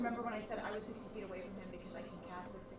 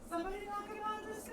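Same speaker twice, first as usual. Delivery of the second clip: higher, urgent call; desperate call for help at the table; alarmed plea; 12 semitones higher, faster than usual. Real, not acted. Player alarmed as villain flies.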